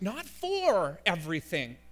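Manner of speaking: slow phrase with a big swell on "for"; stress on FOR. Shown as a demonstration. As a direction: punch "for" as the word to reject. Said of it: "the preposition for".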